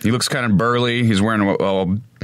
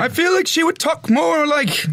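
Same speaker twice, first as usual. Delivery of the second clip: lady voice. Pretend. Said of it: "that's still a man voice".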